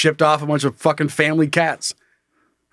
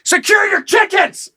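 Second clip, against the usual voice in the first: mock outraged shout; 12 semitones higher than usual. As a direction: yell it like you're fed up with the neighbors. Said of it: "It's like".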